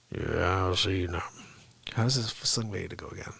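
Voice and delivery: low, soft Sling Blade grunt. Retold or imitated. Comedian trying the Sling Blade voice, low and quiet.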